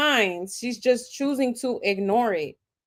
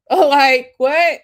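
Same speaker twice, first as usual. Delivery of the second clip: laughing; 4 semitones higher, 9 dB louder than usual. Real, not acted. Laughs in disbelief at story.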